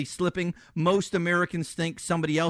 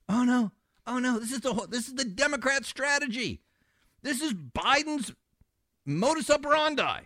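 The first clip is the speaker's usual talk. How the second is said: sarcastic; 8 semitones higher than usual. Real, not acted. Host derides Democrat strategy.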